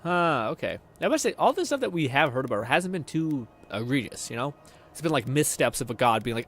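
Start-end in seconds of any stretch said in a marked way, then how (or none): none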